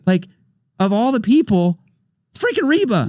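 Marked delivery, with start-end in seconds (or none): 2.3-3.1 much higher, amazed; can't believe it's her; incredulous excitement. Retold or imitated. Incredulous at [name] inviting him.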